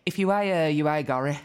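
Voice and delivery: amused mimicry of a Dudley accent; Dudley receptionist. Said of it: "who was from Dudley".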